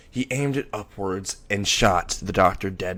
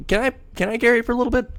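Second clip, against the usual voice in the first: much higher, fast, eager pleading; eager begging; 13 semitones higher, much faster than usual. Acted. Moxie eagerly begging to carry the baby. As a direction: beg like an excited kid.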